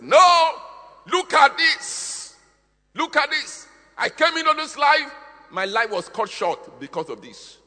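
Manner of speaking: loud, high acted outcry; crying out. Preacher voices shed blood protesting its short life, high shouted cry. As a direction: shout it as a wronged voice crying out, easing at the end. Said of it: "There are voices that cry".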